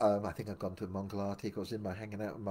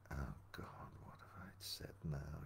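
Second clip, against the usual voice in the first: breathless unvoiced whisper; horrified whisper; 7 semitones lower, 17 dB quieter than usual. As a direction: whisper it to yourself in dismay. Panicked private thought after faux pas.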